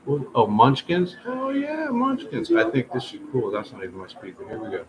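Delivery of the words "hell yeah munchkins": high, thrilled cheer; excited delight. Real, not acted. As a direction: squeal with delight. Delight at Munchkins.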